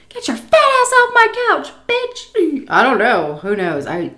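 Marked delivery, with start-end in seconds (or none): 0.1-2.6 very high, louder caricature voice; mock scolding. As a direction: scold in an exaggerated high put-on voice. Imagined scolding from the woman.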